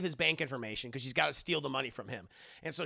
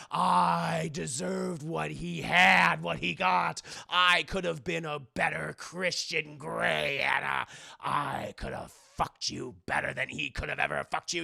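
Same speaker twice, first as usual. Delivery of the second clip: menacing villain rant; 4 dB louder, slower than usual. Acted. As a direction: snarl it like a movie villain. Villain ranting.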